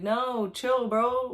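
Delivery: God telling the king to relax; casual calming. Retold or imitated. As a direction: wave off the panic, casually. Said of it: "God's like".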